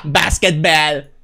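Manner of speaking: slow exaggerated gringo basketball; American accent. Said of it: "toda gringa".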